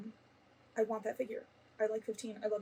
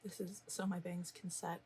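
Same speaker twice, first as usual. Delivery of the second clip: usual speaking voice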